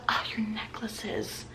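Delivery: breathy imitated whisper of the customer. Whisper as the touchy customer, imitated. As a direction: whisper it like she's touching his chest.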